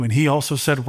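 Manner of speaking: ordinary talk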